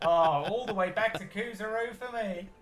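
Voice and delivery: chirpy cockney rogue bowing out of the game; high cockney halfling. Acted. Halfling cheerily quits the card game, high cockney. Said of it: "he says".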